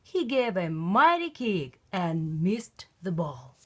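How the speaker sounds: ordinary delivery, nothing marked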